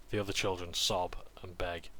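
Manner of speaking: ordinary talk, nothing marked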